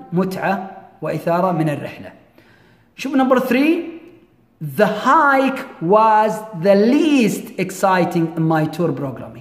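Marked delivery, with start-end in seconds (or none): 4.8-9.4 very slow, deliberate model sentence; slow example reading. Shown as a demonstration. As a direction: read it word by word, leaning on key words. Teacher reads third example.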